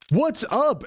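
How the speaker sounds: high bright anime dub voice; overacted English dub line. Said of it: "a dub where it's like".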